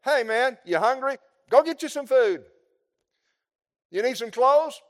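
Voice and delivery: loud, high, breezy brush-off; emphatic brush-off. Retold or imitated. Preacher mocking a hollow brush-off to the needy, loud and breezy. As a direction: toss out the offer like cheap charity. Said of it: "an emphatic way of saying".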